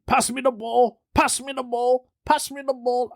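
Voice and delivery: high-pitched, repeated demand for the ball; insistent teammate. Retold or imitated. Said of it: "he kept saying".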